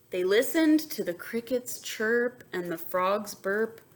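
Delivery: usual speaking voice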